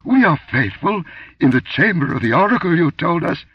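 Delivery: high, loud frightened protest; stammering. A narrator's reading. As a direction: blurt it out in panic. Priest keeps pleading in fear.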